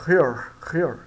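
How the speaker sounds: "Here" said with a throaty R like carro; pronunciation demo. Shown as a demonstration. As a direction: exaggerate the h of "Here". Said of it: "Português de carro".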